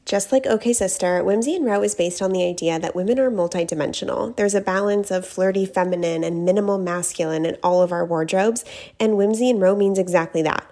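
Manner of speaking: loud, polished personal endorsement; ad read. Acted. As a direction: pitch the brand clearly to listeners. Reads a sponsor ad.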